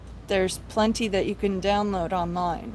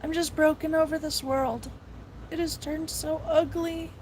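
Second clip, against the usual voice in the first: real tears welling; 5 semitones higher than usual. Real, not acted. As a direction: voice rising higher as emotion breaks through. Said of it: "I'm getting emotional".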